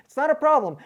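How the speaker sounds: sarcastic. Retold or imitated. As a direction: sneer out "It's not a problem.". Mocking the dealership's policy.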